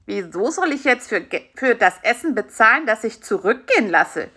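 usual speaking voice

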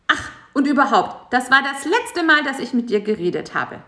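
high, huffy insect breaking off. A narrator's reading. Bisi ending the talk, high and huffy. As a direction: cut off, sulk high.

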